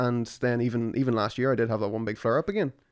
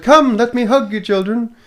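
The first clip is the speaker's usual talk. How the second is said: much higher and louder, grandly tender; hammed-up loving father; 11 semitones higher, 10 dB louder than usual. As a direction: open your arms, over-the-top sweet. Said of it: "And I would have said".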